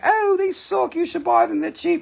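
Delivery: high voice imitating dealers; squeaky mocking vendor voice; high playful imitation; mock sellers. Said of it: "they'll be like".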